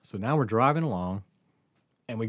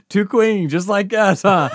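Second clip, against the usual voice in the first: loud teasing joke; 9 semitones higher, 13 dB louder, slower than usual. Retold or imitated. Joking to clerk.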